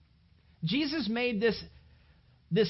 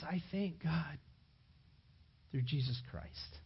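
soft, low, hushed thanks; quiet thanks; 5 semitones lower, 8 dB quieter than usual. Acted. Paul thankfully quietly finding his answer. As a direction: let it settle into relief.

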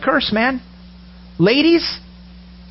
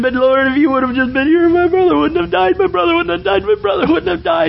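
high wailing grief, raised far up; crying plea; 9 semitones higher than usual. Acted. Martha sobbing to Jesus over her dead brother, high wail. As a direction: wail it out, voice breaking higher. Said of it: "She's crying".

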